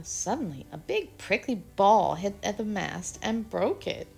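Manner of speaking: plain reading voice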